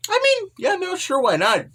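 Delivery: very high, airy why not; breezy shrug. Retold or imitated. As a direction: toss it off carelessly. Scusser-chan breezily allows stay.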